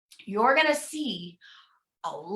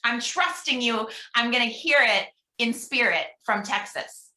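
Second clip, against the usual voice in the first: usual speaking voice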